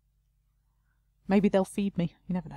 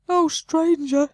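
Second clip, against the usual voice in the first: high, loud, drawn-out moan; 9 semitones higher, 8 dB louder, much slower than usual. Acted. Elven woman moaning to stranger, high drawn-out wail. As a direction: wail it like a weary noblewoman. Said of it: "she moans".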